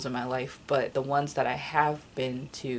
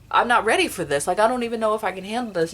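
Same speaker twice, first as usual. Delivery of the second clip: louder and higher, rushing out in distress; a fast, louder cry of not coping; overwhelmed outburst; 6 semitones higher, 7 dB louder, much faster than usual. Retold or imitated. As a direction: blurt it fast, unable to cope. Overwhelmed at cat dying.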